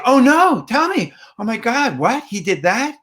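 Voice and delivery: high, animated shock at the gossip; engaged best friend. Shown as a demonstration. Listener to best friend sharing news, eagerly engaged. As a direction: gasp along with your friend's news. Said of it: "let them know that you're hearing".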